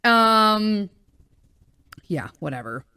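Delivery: usual speaking voice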